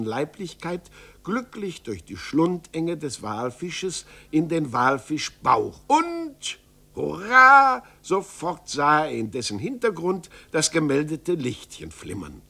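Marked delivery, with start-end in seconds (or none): 5.9-7.8 high, loud joyful cry; narrator's cheer. A narrator's reading. Storyteller cheering as the hero reaches the whale's belly, loud and high. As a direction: burst out in delight.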